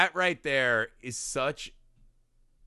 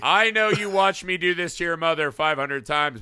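a hint of a sneering husband, higher and louder; mock husband; 5 semitones higher, 7 dB louder than usual. Husband mocking his parents, a lightly voiced sneer. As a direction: play the smug abuser to his parents.

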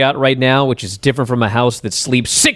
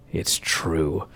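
a much lower, much softer "It's true."; hushed confession; 11 semitones lower, 12 dB quieter than usual. Retold or imitated. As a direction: let the admission drop almost to nothing. MJF's quiet answer to Punk.

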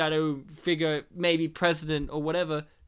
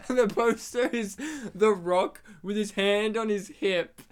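normal talking voice

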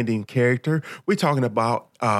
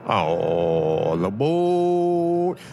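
conductor's call; much slower than usual. Pretend. Conductor calling out to board.

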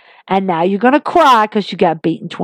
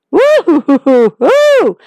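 excited woo; 6 semitones higher, 4 dB louder than usual. Real, not acted. Cheering Becky again.

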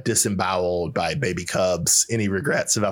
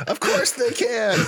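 sarcastic retort; slower than usual. Acted. Mock retort to the quote.

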